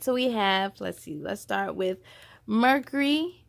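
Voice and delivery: ordinary delivery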